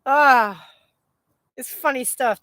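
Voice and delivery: ordinary talk, nothing marked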